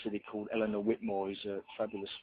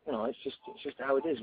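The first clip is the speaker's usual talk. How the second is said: ordinary talk, nothing marked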